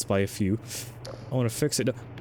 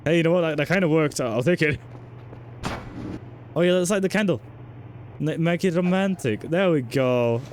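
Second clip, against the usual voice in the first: usual speaking voice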